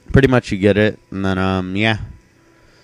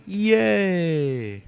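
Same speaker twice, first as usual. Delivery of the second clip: mock yay; 9 semitones higher than usual. Mock cheer after leaving.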